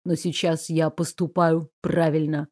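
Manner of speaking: low, soft sad voice; quiet sorrow. A narrator's reading. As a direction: say goodbye gently. Raoul sad farewell.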